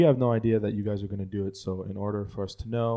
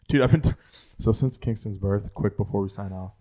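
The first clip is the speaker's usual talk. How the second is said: usual speaking voice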